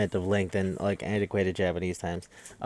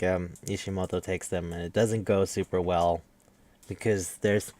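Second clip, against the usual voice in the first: usual speaking voice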